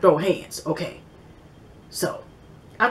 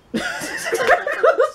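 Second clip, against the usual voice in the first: high laugh. Real, not acted. Laughs at own joke.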